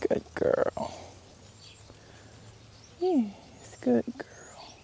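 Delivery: hushed praise for the horse; soft whisper. Real, not acted. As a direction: breathe the praise gently. Soothing a horse while grooming.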